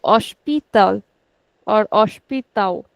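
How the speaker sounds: hospital slowly with PI stretched; slow, leaning on pi in hospital; stress on second last. Shown as a demonstration. Teacher models hospital for students, stressing pi. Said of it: "We'll put on the second last".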